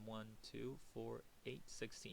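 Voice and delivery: very soft, private tallying; counting to oneself. Real, not acted. Teacher confirming the total to himself, counting softly under the breath. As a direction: count up quietly, just for yourself.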